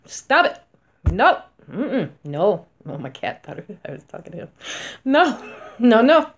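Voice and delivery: stern mock scolding; firm scolding. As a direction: shut the viewer down sharply. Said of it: "scolding the viewer firmly".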